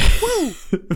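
high loud burst of laughter. Real, not acted. Laughs after viral hit joke. (lautes Lachen)

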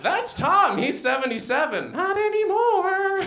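put-on high-pitched, gleeful old-timer; gloating old man. Old man showing off people he's outlived, high gloating voice. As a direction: crow it high, dragging out the last words.

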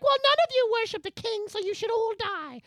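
very high put-on voice; haughty third party. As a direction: pronounce it on them in a high comic voice. Third party joins the acted quarrel.

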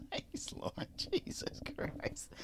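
breathy whisper, slow, under the breath; hushed teasing whisper about a fart; whispered aside. Real, not acted. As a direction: mutter it so the mic barely catches it. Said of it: "Is that story that shitty? You have to fart on air?".

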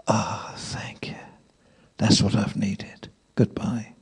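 breathy, whispered relief; dramatic sigh. Retold or imitated. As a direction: sigh it out soft and slow. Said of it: "this great big sigh".

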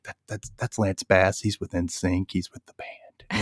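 quietly tipping off the manager, dropping to a whisper; hushed aside. Retold or imitated. Tipping off the manager about Lance, hushed whisper. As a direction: lean in and say it under your breath.

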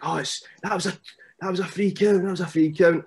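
screaming at the ref. Retold or imitated. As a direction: yell it at the ref like a cocky bad guy. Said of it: "screaming at the ref".

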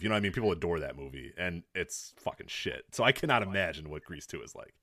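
ordinary talk, nothing marked

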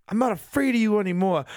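high-pitched, hammed-up little hero; mock brave declaration. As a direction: imitate a timid hero finding courage. Acting Krillin facing his fears.

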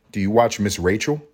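ordinary talk, nothing marked

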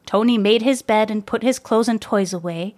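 usual speaking voice

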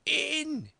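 very high, loud repeated command; frantic, eager high yell; enraged shout. A narrator's reading. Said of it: "His voice sounded louder and higher".

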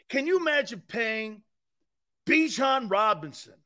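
ordinary talk, nothing marked

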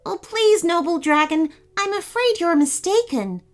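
high, urgent, courteous plea; pleading prince. A narrator's reading. Prince begs the noble dragon to listen, pleading.

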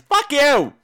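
sudden loud snap at the fan; mock outburst; mock retort.

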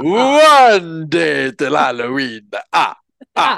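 the Count. Acted. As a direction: count the days like a vampire puppet. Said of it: "the Count from, uh, Sesame Street".

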